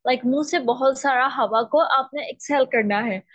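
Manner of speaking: usual speaking voice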